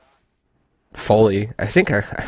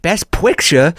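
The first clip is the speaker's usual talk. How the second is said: lisping "Best Picture?" question; slight lisp; 6 semitones higher, slower than usual. Retold or imitated. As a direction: lisp it, higher pitched. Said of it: "I spoke in that voice, the slight lisp".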